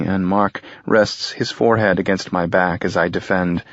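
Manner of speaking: ordinary delivery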